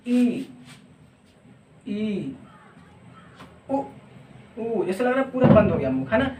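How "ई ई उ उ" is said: ई and उ held long, mouth nearly closed; vowel demo. Shown as a demonstration. Teacher demonstrating closed vowels to students. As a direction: stretch out ई then उ slowly. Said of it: "देखिए".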